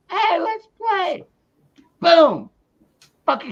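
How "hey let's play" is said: high, slow, silly voice for the friendly dog; happy dog voice. Retold or imitated. Streamer voicing the dog running up, playful high voice. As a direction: play the wagging dog asking to play.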